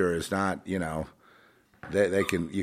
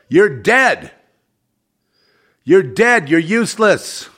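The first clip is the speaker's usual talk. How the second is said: yelling, much louder and higher; furious outburst, real anger boiling over; worked-up rant; 11 semitones higher, 13 dB louder, slower than usual. Real, not acted. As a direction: shout it out, losing your temper. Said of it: "I've gotten all excited now".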